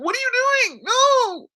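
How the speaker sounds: very high panicked squeal, imitating; mock scared. Guy running from a fight challenge, mock panicked squeal. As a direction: squeal like a coward backing away. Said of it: "was all scared and shit".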